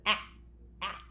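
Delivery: AH cut short, said twice with a gap; a quick little "ah", then another; short vowel, quick. Shown as a demonstration. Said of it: "real quick".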